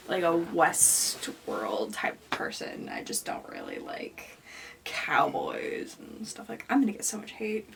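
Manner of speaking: hushed guilty whisper. Real, not acted. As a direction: whisper it like a confession. Confesses an unpopular opinion aside.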